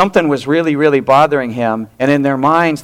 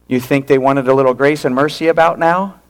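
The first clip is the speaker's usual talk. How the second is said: ordinary delivery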